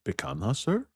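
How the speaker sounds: weird put-on accent, leaping high on "sir"; mock waiter with a fake Brazilian accent. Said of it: "Stop with the accent".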